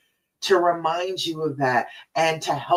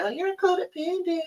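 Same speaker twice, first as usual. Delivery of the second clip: high, soft mimicking voice; mocking critics; 10 semitones higher, 5 dB quieter than usual. Coach imitating people who judge her client, high mocking voice. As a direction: mimic a naysayer, pitched up and softer.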